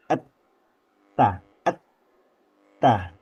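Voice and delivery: "Et, ta" with the t stopped firmly before the vowel; held-back ta. Shown as a demonstration. Teacher drilling the strong held ta. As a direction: block the air hard on "et". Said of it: "Sifatnya syiddah, kuat tertahan".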